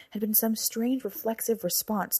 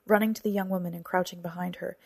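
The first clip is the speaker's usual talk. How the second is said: usual speaking voice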